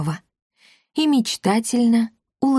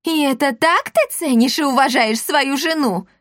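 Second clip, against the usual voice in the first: wailing; 7 semitones higher than usual. A narrator's reading. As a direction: wail it out, offended. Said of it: "завопила Эсмеральда".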